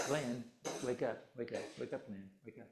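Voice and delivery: soft, fading murmur to wake him kindly; gentle wake-up. Shown as a demonstration. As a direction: coax him awake very softly. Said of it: "Or we can say".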